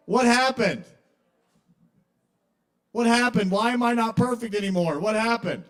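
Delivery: high, loud bewildered wail; mock childish despair. Little kid no longer perfect, wailing in comic bewilderment. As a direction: plead loudly like a wronged little kid.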